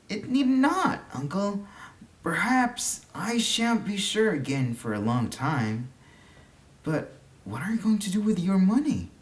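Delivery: put-on high, eager young boy; lightly voiced child, keen to explain; a boy's bright voice. A narrator's reading. Boy answers uncle earnestly.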